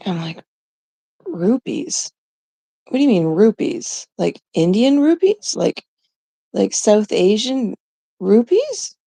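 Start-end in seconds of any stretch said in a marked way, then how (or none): 1.3-9.0 baffled, pitch climbing to each question's end; incredulous questioning. Retold or imitated. Retold reaction to odd payment option.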